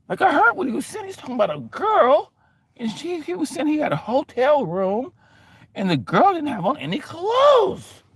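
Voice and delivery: high, shocked retelling of overheard talk; panicked eavesdropper. Retold or imitated. Eavesdropper reports misheard talk, high and shocked. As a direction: blurt it out scandalized.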